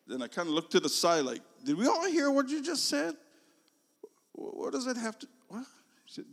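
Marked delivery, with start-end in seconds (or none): 1.6-5.7 baffled. Retold or imitated. Confused student looking around.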